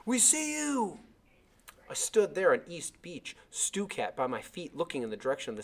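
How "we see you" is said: shout. Acted. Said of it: "There was a shout".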